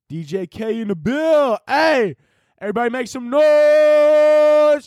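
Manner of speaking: high, drawn-out DJ crowd hype; DJ hype announcer. Pretend. DJ working up the crowd, hype announcer shout. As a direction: stretch the last word into a high, loud call. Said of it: "I would be called D J".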